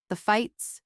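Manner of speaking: ordinary delivery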